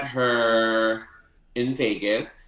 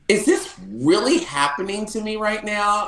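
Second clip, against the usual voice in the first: higher, breathless disbelief; can't believe this is real; starstruck disbelief; 11 semitones higher, 3 dB louder than usual. Retold or imitated. Backstage with [name], recalling a higher starstruck disbelief.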